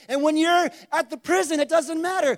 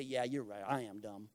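much lower and softer, a beaten-down agreement; defeated mumble; 14 semitones lower, 14 dB quieter than usual. Acted. Believer giving in to the enemy, low defeated mumble.

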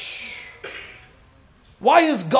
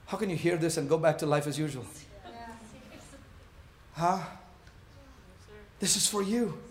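much softer, hushed pastoral voice; soft intimate preaching; 6 semitones lower, 13 dB quieter, faster than usual. Acted. Pastor quietly pressing the church, hushed preaching. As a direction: lean in and speak it low to each listener.

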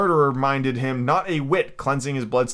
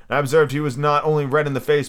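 usual speaking voice

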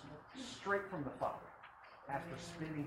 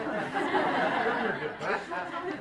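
high burst of laughter; 12 semitones higher, 10 dB louder than usual. Real, not acted. Laughs at own story.

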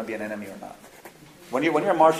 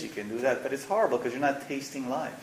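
ordinary talk, nothing marked

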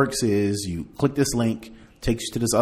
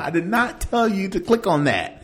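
much higher, mock exasperated; exasperated tech support; 13 semitones higher, 8 dB louder than usual. Tech support scolding a caller, mock exasperated. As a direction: play the fed-up helper. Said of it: "I'm like".

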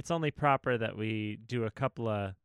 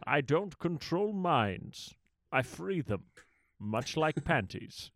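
Connery voice; 4 dB quieter, slower than usual. Pretend. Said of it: "Sybok a la Connery".

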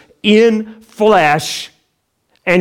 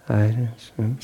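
drowsy mumble; 5 semitones lower, 7 dB quieter than usual. Retold or imitated. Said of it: "I'm like".

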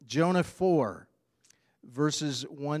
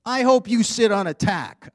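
put-on louder, higher spiteful child; mock angry kid; 10 semitones higher, 13 dB louder than usual. Alexander telling off Paul, mock spiteful and louder. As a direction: spit out the wish like a sulky boy. Boy curses friend in anger.

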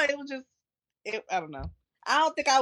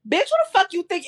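mock Shekinah confrontation; 9 semitones higher than usual. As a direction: imitate her coming on too strong. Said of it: "you come there like".

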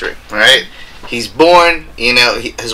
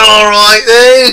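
loud, slow, high English bloke; hammy English drunkard voice; English accent; 10 semitones higher, 6 dB louder, much slower than usual. Pretend. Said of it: "He's like".

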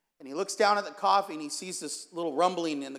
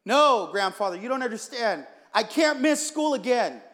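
louder, raised pleading protest; exasperated protest; 4 semitones higher, 6 dB louder than usual. Acted. Grandson arguing with grandfather over coffee, exasperated. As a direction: argue back urgently.

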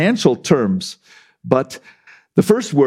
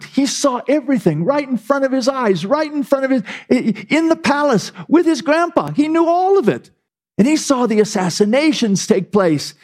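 high, building preaching cadence; impassioned sermon build; rising indignation; 10 semitones higher than usual. Acted. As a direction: pile up the charges. Building indictment of Belshazzar.

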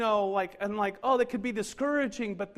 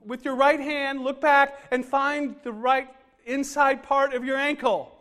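higher, louder instructor giving directions; yoga instructor; 5 semitones higher, 6 dB louder than usual. Retold or imitated. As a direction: call out the pose like a class leader. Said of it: "she says".

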